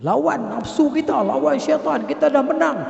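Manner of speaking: ordinary talk, nothing marked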